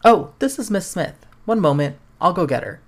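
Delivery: cheerful mom picks up the phone; mother answering phone. Acted.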